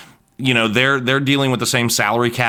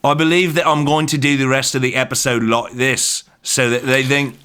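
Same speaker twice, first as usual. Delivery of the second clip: put-on British accent. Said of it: "I'm normally so much better at a British impression".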